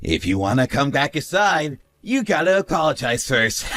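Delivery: normal talking voice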